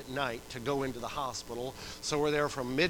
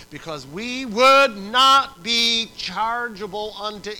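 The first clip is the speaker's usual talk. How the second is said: loud, slow, raised pulpit voice; emphatic scripture reading; 7 semitones higher, 15 dB louder, slower than usual. Real, not acted. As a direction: proclaim the verse word by word.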